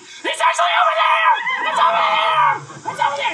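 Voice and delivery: put-on high, loud children shouting; panicked kids pointing; screaming kids. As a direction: voices of the farm crowd yelling. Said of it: "And they're like".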